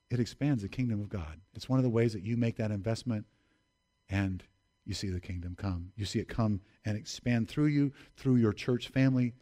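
normal talking voice

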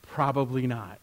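soft, hushed musing to oneself; humble private admission; reflective self-questioning. Retold or imitated. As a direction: ponder it quietly, ending low. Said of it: "before God and being like".

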